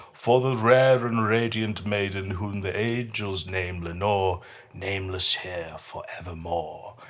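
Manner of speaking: slow, solemn reading of a gothic verse; poem recital. Acted. Host reads his stanza of The Raven to listeners, solemn recital. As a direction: let each line linger like a mournful memory.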